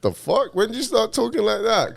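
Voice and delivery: high-pitched London disbelief; mock-baffled, voice jumping way up; incredulous brother. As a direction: imitate a brother's astonished challenge. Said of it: "if my brother comes to visit me".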